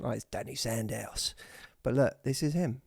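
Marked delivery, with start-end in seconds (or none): 0.2-1.3 put-on Cockney accent. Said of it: "a real Cockney accent".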